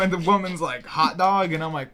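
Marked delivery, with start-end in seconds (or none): 0.9-1.5 high, loud questioning rise; puzzled cashier. Retold or imitated. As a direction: repeat it back like a baffled cashier.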